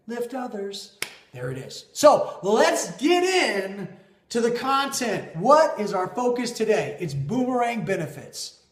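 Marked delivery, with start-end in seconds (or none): 1.9-7.0 loud, high, stretched-out kickoff; hyped intro. Real, not acted. Host launching into today's topic, pumped up. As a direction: pump up the audience to start. Kicking off the lesson content.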